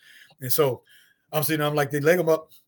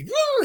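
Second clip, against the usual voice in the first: high, louder horse scream; imitated horse squeal; 6 dB louder than usual. Horse rearing again.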